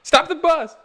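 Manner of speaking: high, loud shouted movie line; movie quote shout. Retold or imitated. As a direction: yell it like the film scene. Quoting a Spider-Man movie line.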